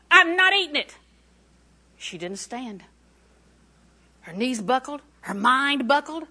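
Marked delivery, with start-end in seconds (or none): 0.1-1.0 high, loud acted refusal; firm refusal. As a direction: cut the tempter off emphatically. Acts out Eve refusing again.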